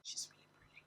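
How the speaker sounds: soft breathy whisper. Real, not acted. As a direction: confide it under your breath. Aside whispered over film.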